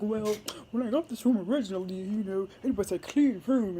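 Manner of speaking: imitating an indignant foreign guest, put-on voice; livid foreign man. Retelling the displaced guest's complaint, hammed-up indignant voice. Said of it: "this foreign dude is livid".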